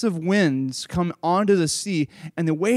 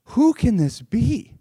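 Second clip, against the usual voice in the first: slow, higher-pitched wonder; amazed; 4 semitones higher, 4 dB louder, slower than usual. Acted. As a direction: draw out the question in acted awe. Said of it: "They're, like, amazed".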